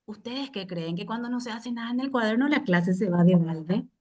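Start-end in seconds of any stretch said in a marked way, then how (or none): none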